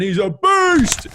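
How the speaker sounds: BEAST drawn out high and loud; slow, booming shout of praise; hyped-up exclamation. Real, not acted. Podcaster raving about his favourite host, hyped-up shout. Hyping up the guest he admires.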